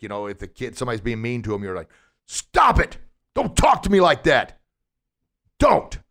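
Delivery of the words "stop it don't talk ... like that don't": loud, much higher commanding shout; lion voice. Shown as a demonstration. A kid telling the bully to stop, bold loud shout. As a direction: stand up and shout it down with conviction. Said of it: "it sounds like".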